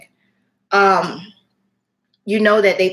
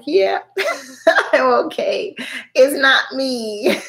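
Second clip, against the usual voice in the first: higher, laughing as she reassures him; laughing through tears; 6 semitones higher, slower than usual. Retold or imitated. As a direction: laugh it off while still shaken. Answering him while crying.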